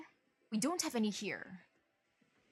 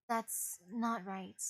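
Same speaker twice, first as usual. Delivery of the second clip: slow, grim; 2 semitones lower, much slower than usual. Acted. Repeats line slowly and quietly.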